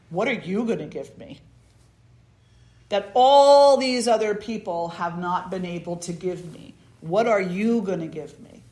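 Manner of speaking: loud, scornful demand at the beggar; the king sneers at the beggar, loud and scornful. Acted. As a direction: challenge him with contempt.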